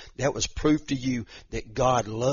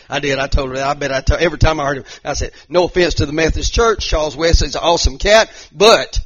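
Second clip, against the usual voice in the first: emphatic retelling; 4 semitones higher, 9 dB louder than usual. Retold or imitated. Emphatic retold remark.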